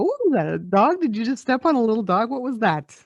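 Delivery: high-pitched, incredulous questioning; baffled reaction. Retold or imitated. People reacting to her strange stifled sneeze, baffled.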